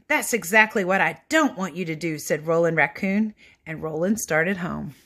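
put-on lively read-aloud for children; animated picture book narration; storybook reading. A narrator's reading. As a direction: read it like bedtime story time.

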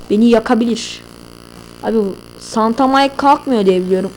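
ordinary talk, nothing marked

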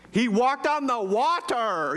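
slow, high-pitched exclamation; drawn-out, amazed objection; incredulous protest. Acted. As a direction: stretch out every word in playful disbelief. Mock protest to dismissive listeners.

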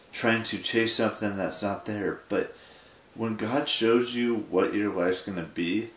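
normal talking voice

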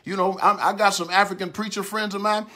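usual speaking voice

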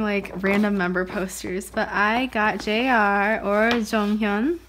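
usual speaking voice

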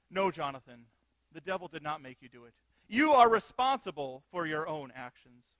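a teacher correcting a pupil, stern and deliberate. Retold or imitated. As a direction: lay down the law to the child. Teacher scolds the boy.

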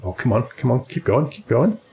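urging the ball on, rising; cheering someone on. Real, not acted. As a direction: come on, keep going, excited.

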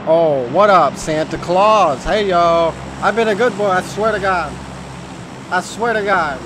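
loud mock-eager pleading; hyped greeting. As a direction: yell it up at the plane like a kid. Greets a player as Santa Claus.

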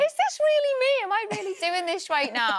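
high, disbelieving gasp; incredulous. Retold or imitated. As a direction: voice it in wonder. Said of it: "I'm like".

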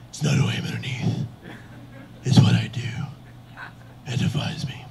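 raspy whispered vigilante voice; Batman. Acted. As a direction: rasp it solemnly without full voice. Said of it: "I'm Batman".

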